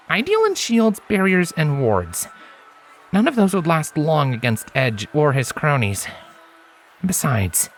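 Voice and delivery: a grinning friend talking her round; Lens, easygoing stallion. A narrator's reading. Lens urging Scarlet to rest, easygoing.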